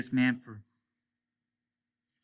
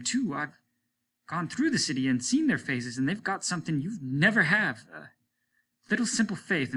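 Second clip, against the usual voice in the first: higher, rising protest against the captain; heated defiance; 6 semitones higher, 3 dB louder than usual. Acted. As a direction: stand up to him passionately. Martin defies the captain.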